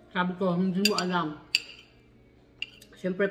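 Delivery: speaking with food in mouth; words muffled by chewing; talking while chewing. Real, not acted. Seen 0: Puts food in mouth at -1; closed-lip chewing visible 0.4–3.0.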